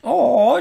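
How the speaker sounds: high, loud drawn-out howl; imitated fight cry. Co-host mimicking the voice actor, high loud howl. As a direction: belt out a mock kung-fu cry. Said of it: "yelling into a microphone".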